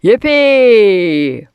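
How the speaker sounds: loud whoop, falling away; long loud cheer; crying out. A narrator's reading. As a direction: whoop it with glee. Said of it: "cried out".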